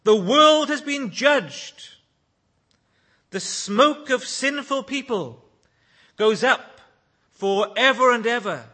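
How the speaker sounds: loud, high jubilant proclamation; exultant shout. Pretend. Pastor imagining heaven's song, loud and exultant. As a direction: belt it out like a triumphant crowd. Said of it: "Can you imagine singing that?".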